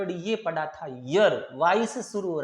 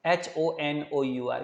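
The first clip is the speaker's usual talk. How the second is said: ordinary talk, nothing marked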